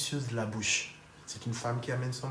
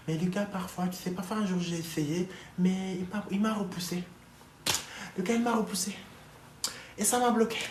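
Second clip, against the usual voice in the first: higher mock complaint, climbing as it goes; plaintive wife impression; 6 semitones higher than usual. Speaker mimics a rebuffed wife confessing, plaintive and high. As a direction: imitate a wounded wife making excuses.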